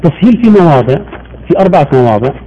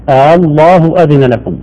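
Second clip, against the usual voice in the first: normal talking voice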